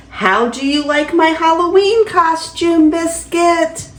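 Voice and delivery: lively, sing-song picture-book reading; grandma reading a bedtime story; reading to children. Acted. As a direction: read it to a small grandchild with delight. Grandma reads picture book aloud.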